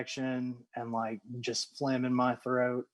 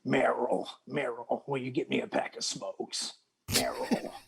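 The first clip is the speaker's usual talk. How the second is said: mock raspy caller. Imitating a raspy voice calling out.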